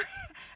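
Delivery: high breathy giggle; soft laugh. Real, not acted. Mother calling God a prick to viewers, giggling softly. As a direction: giggle at your own cheek.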